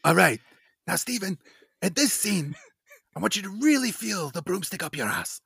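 high-pitched director giving an actor notes; Mel Brooks. Retold or imitated. Imagined director instructing his stiff actor on a scene, mock coaching. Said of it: "and Brooks was like".

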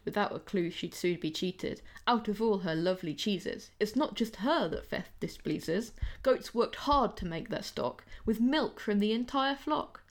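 ordinary delivery

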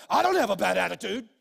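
hammed-up defensive retort; defensive denial. Worker denying to the boss, loud and indignant. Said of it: "And you're like".

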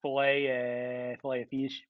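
low, quiet, drawn-out pretend customer; hesitant mock order. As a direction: order haltingly in a low put-on voice. Acting out posh filet order.

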